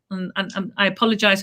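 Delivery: ordinary delivery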